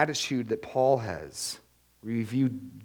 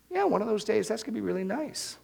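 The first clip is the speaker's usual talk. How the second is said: mock half-interested agreement; noncommittal; 8 semitones higher, faster than usual. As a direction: put it off lightly, pitched up. Acted cooled enthusiasm, putting it off.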